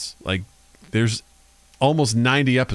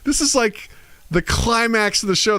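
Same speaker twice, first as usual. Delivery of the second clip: mock whimper; 11 semitones higher, slower than usual. Complaining the climax was cut off, high mock whimper. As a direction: whine like a fan left hanging. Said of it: "I was like".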